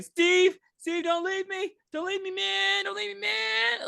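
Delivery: high, mock-whiny plea, dragging the last one; hammed-up begging not to be left; desperate pleading. Gavin begging his friend Steve, in a high mock plea. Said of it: "And he's like".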